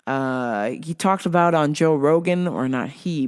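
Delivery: ordinary talk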